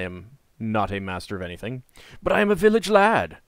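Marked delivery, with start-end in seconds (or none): 2.2-3.4 higher, lightly heroic boast; a hint of proud hero. Repeats heroic declaration.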